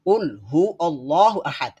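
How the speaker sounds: slow recitation of "কুল হু আল্লাহু আহাদ"; slow Quranic chant with a heavy কুল. Shown as a demonstration. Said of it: "আর আমরা সূরা ইখলাস পড়ি".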